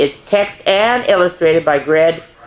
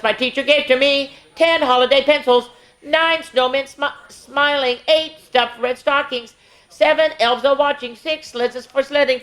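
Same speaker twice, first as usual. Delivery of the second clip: lively sing-song counting rhyme; reading to children; 6 semitones higher than usual. Acted. Miss Tasha reading a Christmas counting book to kids, sing-song. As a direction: read it out brightly, verse by verse for the little ones.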